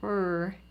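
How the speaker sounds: a soft, low "R" sound; a quiet drawn-out "R"; letter sound r. Shown as a demonstration. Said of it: "say the sound that R makes".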